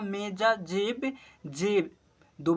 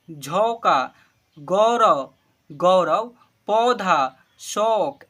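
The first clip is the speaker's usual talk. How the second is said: each word called out louder and clear, one by one; loud word-list reading; 6 dB louder, much slower than usual. Shown as a demonstration. Teacher calling out Hindi words to pupils, loud and clear. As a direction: read every word out loud for the class to repeat.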